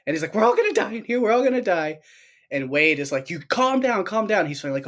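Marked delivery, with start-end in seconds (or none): 0.3-2.0 high, panicky imitation; hammed-up high-pitched alarm; mock panic. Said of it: "moment of panic before they head out". 3.3-4.5 high, insistent imitation; mock urgent order. Retells Wade ordering him to calm.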